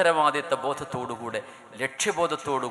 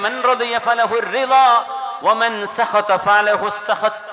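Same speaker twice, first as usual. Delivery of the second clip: recited hadith; 9 semitones higher, 10 dB louder, much slower than usual. Acted.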